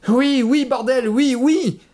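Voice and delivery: very high, loud frantic yell; frantic, impatient screaming; desperate shouting. A narrator's reading. Trapped man screams his agreement.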